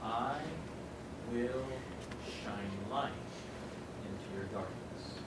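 Jesus to the grieving, softly reassuring; tender promise. Retold or imitated. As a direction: reassure gently, word by word. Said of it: "and Jesus says".

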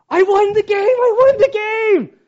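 loud, very high holler; triumphant shout. Retold or imitated. Dad taken away waves to his kid, triumphant shout. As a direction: yell it across the yard in victory.

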